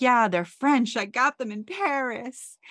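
imitating an excited Stanford showing off; excited boast. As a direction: brag about the French underwear, lightly imitated. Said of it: "Stanford's very excited".

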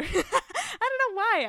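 笑着说; 边笑边说; laughing. Real, not acted. Laughs at own outburst.

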